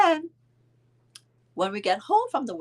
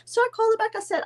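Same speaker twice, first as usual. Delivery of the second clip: normal talking voice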